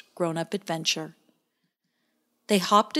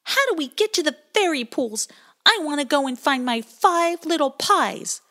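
demanding tone; 9 semitones higher, 5 dB louder than usual. A narrator's reading. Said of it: "in a demanding tone".

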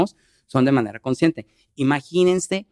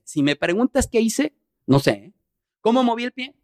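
ordinary talk, nothing marked

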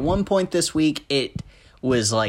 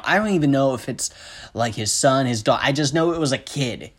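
usual speaking voice

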